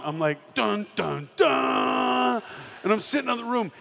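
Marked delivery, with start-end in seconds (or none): 0.5-2.4 loud, rising dun dun dun; boy voicing a movie sting as the magazine is gone, loud; dramatic sting.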